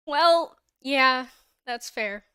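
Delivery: bursts out loud then eases off slow; loud amused concession. Real, not acted. Streamer reading a teasing chat comment, loud amused concession.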